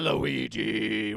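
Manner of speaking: loud drawn-out yell; screamed name. Pretend. Player screaming Luigi to survive, bellowed.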